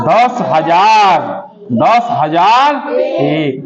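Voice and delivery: very slow, every word drawn out; teacher dictating the answer word by word; slow spelled-out reading. Shown as a demonstration. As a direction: stretch each word for the class to copy. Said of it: "तो क्या इसका नाम क्या होगा".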